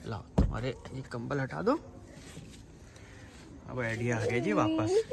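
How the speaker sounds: hush, he's dozing off; soft hush. Real, not acted. Softly settling the sleepy dog. (सोते कुत्ते के पास धीमी, धीरे आवाज़)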